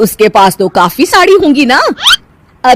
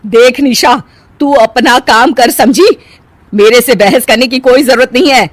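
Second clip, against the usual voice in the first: louder, indignant put-down; stern scolding; 4 semitones higher, 5 dB louder than usual. Acted. Saas telling Nisha not to argue, stern and raised. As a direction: shut the argument down.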